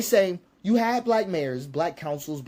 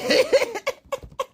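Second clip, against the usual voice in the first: sudden high burst of laughter. Real, not acted. Laughing at a viewer's comment, a high burst. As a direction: let out a high incredulous laugh.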